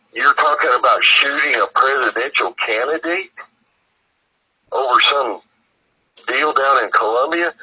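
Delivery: can't believe what he's hearing; alarmed disbelief. Retold or imitated. Retelling alarm at buddy's plan.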